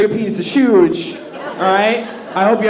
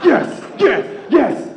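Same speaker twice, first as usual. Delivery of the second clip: silent glee; slower than usual. Retold or imitated. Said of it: "I'm having a mental party".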